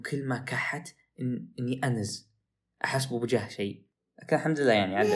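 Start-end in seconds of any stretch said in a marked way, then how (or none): none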